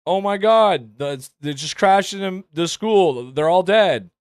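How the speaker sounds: limp, half-hearted newscaster panic; flat bad delivery. Mocking the reporter's weak original line, flat half-hearted alarm. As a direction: deliver grief with no conviction. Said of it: "than what she said".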